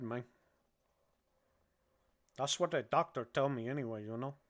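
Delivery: put-on lightly held Cuban gangster accent; a hint of the Cuban accent, half-crazed and joking. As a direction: keep the Cuban accent, loose and rambling. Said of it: "my accent".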